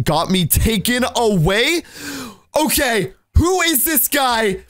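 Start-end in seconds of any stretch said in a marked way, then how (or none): 2.6-4.6 high-pitched, drawn-out demand; alarmed outburst. Real, not acted. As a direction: cry out in disbelief. Alarmed reaction to the stalker.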